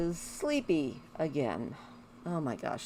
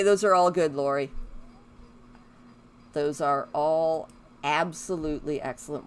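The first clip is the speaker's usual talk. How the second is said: ordinary delivery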